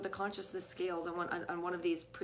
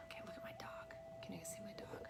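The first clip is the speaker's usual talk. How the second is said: hushed whisper so the dog is not disturbed; 14 dB quieter than usual. Real, not acted. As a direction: breathe the words, no voice at all. Quietly pointing out the dog.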